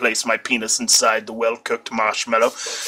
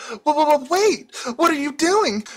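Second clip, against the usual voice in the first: startled stammer. A narrator's reading. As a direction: stumble over panic. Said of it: "she questioned, obviously startled".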